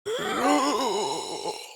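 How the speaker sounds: gasp. Acted. Sudden gasp.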